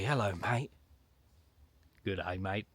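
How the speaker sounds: lightly put-on Aussie greeting; casual mock-Australian accent. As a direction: greet like a laid-back Australian. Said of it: "Don't know why I've gone Australian".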